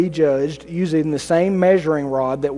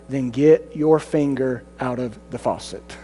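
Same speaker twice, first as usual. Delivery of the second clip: lower, softer, slower retort from God; a calm, low, dry comeback; a low, unhurried divine retort; God's dry reply; 5 semitones lower, 4 dB quieter, slower than usual. Acted. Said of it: "and God might answer".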